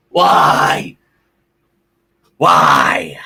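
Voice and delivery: loud, drawn-out wail of frustration; agonised fan shouting; anguished shout. Real, not acted. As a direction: cry out at the team in exasperation. Anguished venting over the loss.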